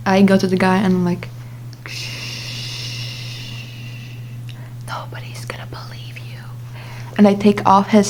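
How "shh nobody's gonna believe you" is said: long shush into a whisper; creepy joke whisper. Retold or imitated. Friend group's freaky joke, whispered after a long shush. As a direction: hush them, then whisper it very softly.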